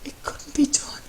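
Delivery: a high, breathless whisper of despair. Acted. Matt whispering his doubt to his husband. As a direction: barely voice the words, defeated.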